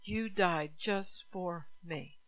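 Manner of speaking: "You died just for me" said very slowly, stressing "You"; slow personal emphasis. Shown as a demonstration. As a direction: make it personal, slow and deliberate. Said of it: "make it personal".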